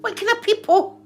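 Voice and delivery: mock-scandalised high squawk; incredulous high outrage. Questioning her mother's strange company, a high incredulous squawk. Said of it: "And I was like".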